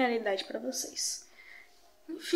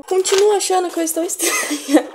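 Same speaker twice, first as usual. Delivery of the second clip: mock despair; 6 semitones higher, 8 dB louder than usual. Real, not acted. Dramatic self-complaint at mirror.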